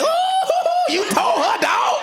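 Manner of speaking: high-pitched mock hooting; bad friend's hype. Bad friend cheering on a husband's argument, hyped-up hooting. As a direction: egg him on in a high, gleeful whoop.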